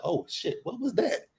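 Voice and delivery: high, hushed startled question; startled waking. Pretend. Imagines waking to a window breaking, high hushed alarm. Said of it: "wake up and be like".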